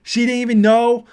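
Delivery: loud, high, bad New York accent; hammed-up New Yorker voice; New Yorker impression. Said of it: "I cannot do New Yorker".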